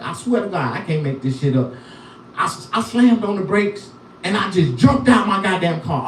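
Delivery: normal talking voice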